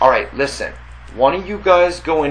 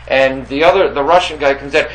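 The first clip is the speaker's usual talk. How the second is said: normal talking voice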